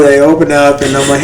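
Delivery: usual speaking voice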